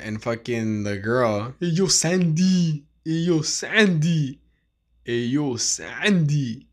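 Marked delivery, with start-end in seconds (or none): 1.6-6.7 slow, drawling "Hey, yo" tough guy; Sylvester Stallone impression. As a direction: drop into a Stallone mumble. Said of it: "That's my impression of Sylvester Stallone".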